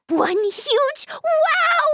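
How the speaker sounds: drawn-out, high wow; awed excitement. Acted. Recounting something huge and fun, excited and awed. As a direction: stretch it out in soaring amazement.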